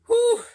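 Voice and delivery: loud high whew. Real, not acted. Exhales after intense preaching.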